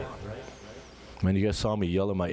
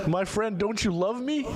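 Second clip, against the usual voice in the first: anguished plea; 13 semitones higher than usual. Retold or imitated. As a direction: cry out to God in grief. Prophet's anguished plea to God.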